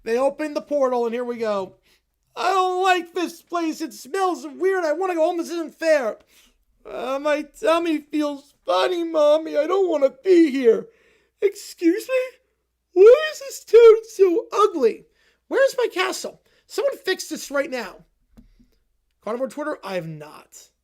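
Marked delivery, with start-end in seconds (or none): 2.4-10.9 put-on high, slow, whining child near tears; whiny kid. Kid whining to mommy in the Upside Down, near tears. 11.4-18.0 high, loud, outraged princess whine; spoiled brat. Acted. Spoiled kid scolding the ugly town, outraged whine.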